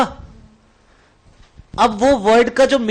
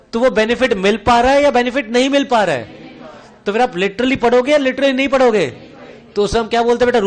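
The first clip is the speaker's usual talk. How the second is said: ordinary talk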